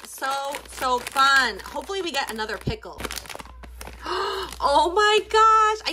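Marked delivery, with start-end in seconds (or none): 3.1-4.6 high, soft surprised intake of breath; sharp gasp. Real, not acted. Opening a blind bag, a high soft gasp. 4.6-5.9 much higher, louder, slow oh my gosh; surprised exclaim. Real, not acted. Seeing the new toy, a high slow surprised exclaim.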